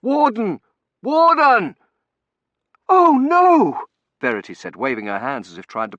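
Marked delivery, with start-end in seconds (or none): 0.0-1.8 high, loud calls, building; shouting. A narrator's reading. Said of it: "I shouted". 2.9-3.9 high, loud cry of dismay; horrified cry. A narrator's reading. Warden buried under falling bricks.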